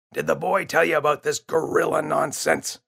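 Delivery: dismissive dad at the dinner table; father's voice. Acted.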